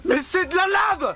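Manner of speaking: high, slow outraged yell; protesting shout. Real, not acted. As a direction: cry out in protest, stretching the words. Proteste, brûlé dans la lave. (cri indigné, aigu et étiré)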